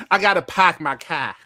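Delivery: broad Boston accent, r's dropped. Shown as a demonstration. Streamer showing off the classic Boston line in a Boston accent. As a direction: say "park my car" like a Bostonian. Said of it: "the Boston accent is like this".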